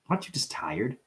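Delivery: ordinary delivery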